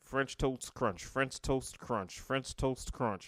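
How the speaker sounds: slow, deliberate, one word at a time; slow and careful. Shown as a demonstration. Doing the tongue twister for a co-host, slow and careful. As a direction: concentrate on each word, take your time. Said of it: "I have to concentrate when I say it".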